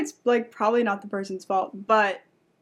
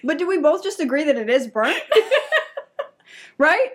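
giggling through a louder, higher protest; laughing indignation; 4 semitones higher, 3 dB louder than usual. Real, not acted. Telling a friend about the burnt pizza, laughing and indignant. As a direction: laugh while insisting on the point.

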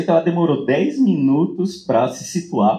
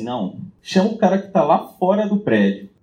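retold teacher's call; 8 semitones higher than usual. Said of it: "o professor falava assim".

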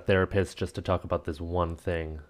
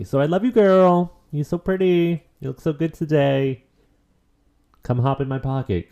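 higher, sweet flirty talk to money; cooing to money; 7 semitones higher, 5 dB louder than usual. Pretend. Host flirts with money, a lightly higher coo. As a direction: woo money like a crush.

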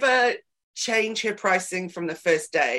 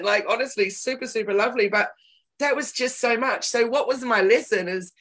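usual speaking voice

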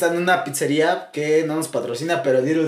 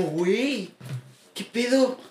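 ordinary talk

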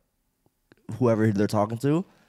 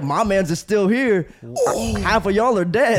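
higher, louder mock taunting; instigating taunt; 8 semitones higher, 4 dB louder than usual. Retelling a cop goading a gang member, higher mock taunt. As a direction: goad them like the cop in the story. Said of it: "He's just instigating?".

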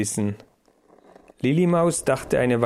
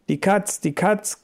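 higher mock alarm cry, rising on Katz; mock warning shout; 8 semitones higher than usual. A narrator's reading. Willibald giving the cat alarm, a higher, rising mock shout.

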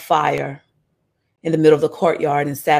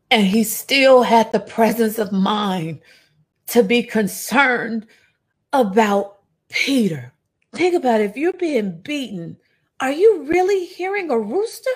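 impassioned preaching; 8 semitones higher than usual. Acted. Preacher urging the congregation to picture Jesus' concern. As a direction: climb in pitch as the point lands. Preacher builds to full force.

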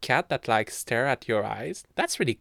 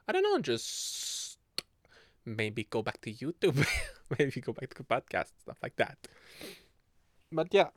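ordinary talk, nothing marked

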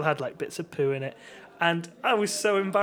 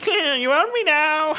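high, loud, slow goblin croon; hammed-up creature voice; hobgoblin; 11 semitones higher, 7 dB louder, slower than usual. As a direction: gloat like a little goblin. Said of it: "bartender was an actual hobgoblin".

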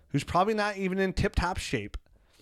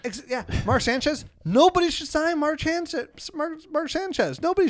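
high-pitched rant; 12 semitones higher, 3 dB louder than usual. Acted. Ranting in a raised voice.

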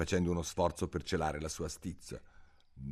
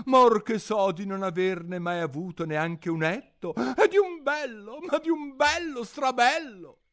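much higher, louder boastful gush; vain father; 7 dB louder than usual. Acted. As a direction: show off your beauty. Il papà si vanta. (papà vanitoso che si pavoneggia)

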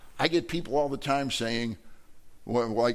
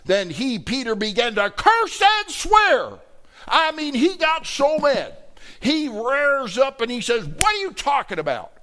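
loud, emphatic; 10 semitones higher, 5 dB louder than usual. Acted. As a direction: raise it to a mad yell to hammer the point. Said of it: "Excuse me if I get a little loud here".

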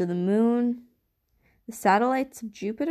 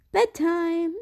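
abrupt bedtime call; 9 semitones higher than usual. A narrator's reading. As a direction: snap "Bedtime!" out to cut off the talk. Mother abruptly ending the talk.

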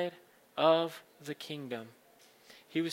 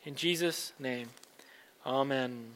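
fervent pastor's prayer; 7 dB louder than usual. Acted. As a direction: pray it with rising fervour. Pastor leads communion prayer.

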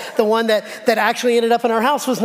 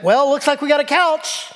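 higher-pitched sarcastic announcement; loud sarcasm; 6 semitones higher than usual. Retold or imitated. Husband turns to wife in the store, loudly sarcastic. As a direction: announce it sarcastically for all to hear. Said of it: "very kind of loudly and sarcastically said".

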